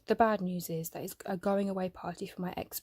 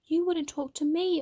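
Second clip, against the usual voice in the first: higher, louder defensive reply; 8 semitones higher, 6 dB louder than usual. Acted. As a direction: defend yourself, stung. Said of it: "I defend myself".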